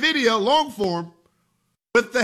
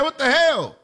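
mock angry parent; 7 semitones higher than usual. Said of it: "You're saying".

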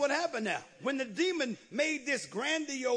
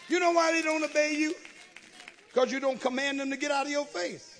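normal talking voice

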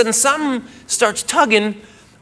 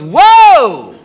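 loud, high, drawn-out whoa; startled whoa; 4 semitones higher, 8 dB louder than usual. Retold or imitated. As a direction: cry out in mock alarm. Girlfriend's startled cry as he dresses.

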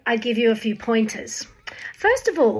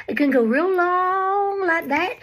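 Tennessee drawl; 4 semitones higher than usual. Shown as a demonstration. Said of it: "Tennessee drawl".

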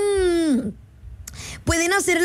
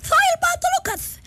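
Daffy Duck. Shown as a demonstration. Host going on with her duck impression, very high and lisping. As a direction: squawk it out like an indignant cartoon duck. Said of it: "también me sale la del Pato Lucas".

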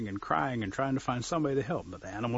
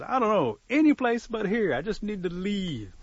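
high-pitched, loud, fed-up whine; acted desperation to get away; exasperated bird; 9 semitones higher, 5 dB louder than usual. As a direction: throw up a frustrated, high complaint. Upset bird wants to leave.

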